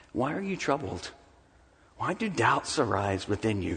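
soft, gentle question to frightened friends; gentle Jesus. Acted. Jesus gently asking his frightened disciples, soft and kind. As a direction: ask it tenderly, like an invitation. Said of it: "Do you hear the gentleness in his voice?".

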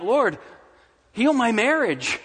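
high, urgent appeal to God; impassioned mock-up of a petition; pleading prayer. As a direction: beg the Lord for help, acted from the pulpit. Acts out a desperate prayer.

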